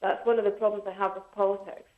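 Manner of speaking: ordinary talk, nothing marked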